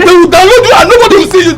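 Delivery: fast, loud, high retort; heated quarrelling shout; shouted taunt. Acted. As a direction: yell it back in a rush.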